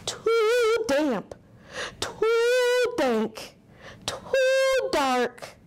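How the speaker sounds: high squeaky little mouse voice. Acted. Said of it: "Mouse squeaks".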